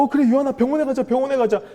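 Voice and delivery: high, loud retold panic; urgent mock-alarmed reassurance; startled, flustered. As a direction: blurt it out like a panicked parent. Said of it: "너무 놀래가지고".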